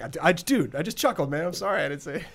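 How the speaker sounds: lightly voiced embarrassed apology; sheepish self quote. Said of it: "He's like".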